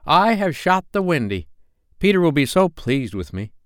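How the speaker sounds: high boast, easing down; proud cry. A narrator's reading. Tootles standing over his victim, proud cry. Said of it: "he cried proudly".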